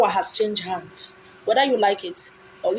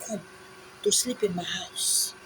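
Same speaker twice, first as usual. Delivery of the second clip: hushed, slow, confiding whisper; 4 semitones lower, 10 dB quieter than usual. Real, not acted. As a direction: drop to a confiding whisper, slower. Confiding a private boast.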